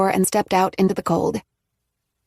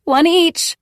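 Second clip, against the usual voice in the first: higher, louder call; calling after; 9 semitones higher, 6 dB louder than usual. A narrator's reading. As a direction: call after the running kids. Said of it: "she called after them".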